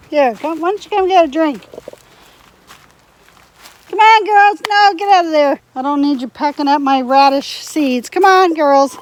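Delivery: high, loud coaxing call; calling chickens. Real, not acted. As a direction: call the hens over brightly.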